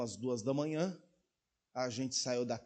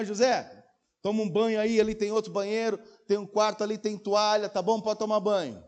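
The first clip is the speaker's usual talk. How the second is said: calling out; 8 semitones higher, 6 dB louder than usual. Retold or imitated. Pastor calling to José at 3am.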